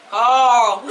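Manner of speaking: put-on Rick's Southern accent. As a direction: exaggerate the Southern drawl, tickled by it. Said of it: "That's how Rick".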